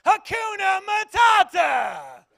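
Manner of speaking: very high, slow shouted praise; church lady shout. Retold or imitated. Church lady finally one-upping the other, high drawn-out shout. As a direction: belt it out to win. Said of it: "She goes".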